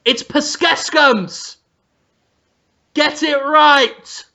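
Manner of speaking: slow, high, emphatic scolding; hammed-up irate chef; mock furious correction. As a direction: correct the waiter in mock outrage. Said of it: "And he's furious".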